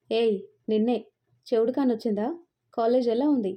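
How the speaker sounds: ordinary delivery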